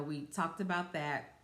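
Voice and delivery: normal talking voice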